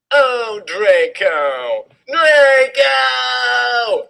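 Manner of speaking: hammed-up high screams of Draco; mock orgasm scream. A narrator's reading. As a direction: shriek the name, over the top. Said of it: "I screamed while getting an orgasm".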